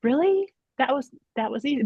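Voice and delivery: a high, rising "Really?" in mock disbelief; surprised daughter. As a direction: imitate the daughter's amazed reaction. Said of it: "And she was like".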